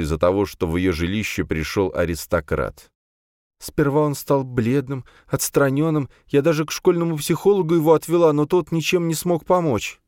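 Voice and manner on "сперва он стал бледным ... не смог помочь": high trembling voice of grieving mother; shaky, higher voice holding back tears. Acted. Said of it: "дрожащим голосом рассказывала женщина".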